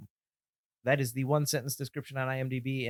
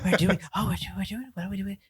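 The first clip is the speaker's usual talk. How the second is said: high, halting little-creature whisper; badly faked creature voice, restarting repeatedly; attempted whispering voice; 6 semitones higher, 4 dB louder than usual. Co-host trying to be the little things, high whispery attempt. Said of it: "I can't do their whispering voice".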